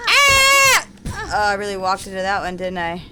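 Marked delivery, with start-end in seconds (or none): 0.1-0.9 loud high yelp of pain; sharp pained yell. Real, not acted. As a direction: yelp as you get hit. Gets hit in the game.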